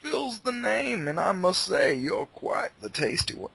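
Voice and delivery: put-on southern accent. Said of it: "He's turning into, like a southern, like, he's turning into the Kentucky Fried".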